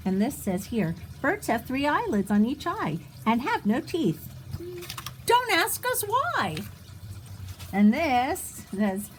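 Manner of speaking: plain reading voice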